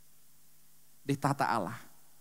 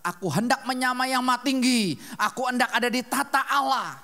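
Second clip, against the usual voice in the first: louder, higher proud declaration; Lucifer's boast; 8 semitones higher, 7 dB louder than usual. Retold or imitated. Quoting Lucifer's boast in Isaiah, raised and proud. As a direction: ring out with arrogant ambition. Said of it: "Yesaya empat belas berkata".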